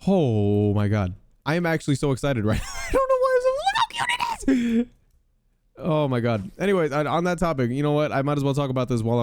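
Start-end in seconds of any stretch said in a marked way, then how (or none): 2.7-4.9 squealing whisper. Real, not acted. Gamer squeals over how cute the ghast is. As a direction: squeal it under your breath.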